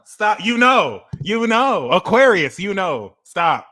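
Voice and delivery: high and loud; worked-up teasing. Real, not acted. Teasing Aquarius, high and loud. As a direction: keep punching it.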